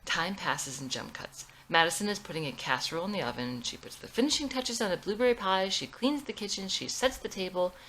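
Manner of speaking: usual speaking voice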